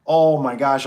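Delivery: alarmed exclamation. Retold or imitated. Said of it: "I was like".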